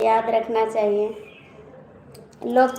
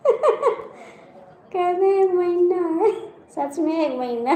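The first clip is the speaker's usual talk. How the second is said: laughing; 5 semitones higher, slower than usual. Real, not acted. Laughs at viewer's comment.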